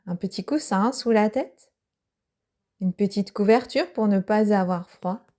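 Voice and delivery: normal talking voice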